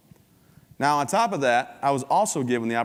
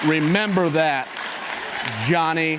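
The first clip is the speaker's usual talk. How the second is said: mock threatening dad; 5 semitones higher, 5 dB louder, slower than usual. Dad's final threat to Johnny.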